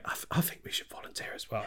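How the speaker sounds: hushed, fast whisper among themselves; whispering extras. Acted. Warriors at the council whispering nervously to each other. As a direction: whisper it quickly, not wanting to be heard.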